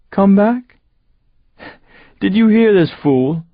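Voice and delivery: high, loud scornful jeer; loud high sneer at the fool; mocking villain. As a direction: ridicule him to the crew. Seth mocking narrator to crew.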